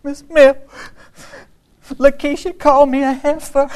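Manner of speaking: put-on high, loud tearful little-girl wail; mock sobbing child. Upset first grader tells on classmate to teacher, mock crying. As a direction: squeak it out between hammed-up sniffles. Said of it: "she could hardly speak. She was so upset".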